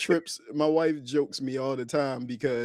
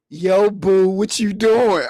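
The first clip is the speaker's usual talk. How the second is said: shouted over the chirp; loud call; 3 semitones higher, 7 dB louder, slower than usual. Retold or imitated. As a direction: call out loud like a walkie-talkie. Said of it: "They just scream through".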